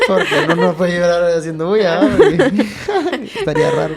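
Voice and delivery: giggling at his own story, voice climbing; laughing. Real, not acted. Laughing while telling friends about crying silently.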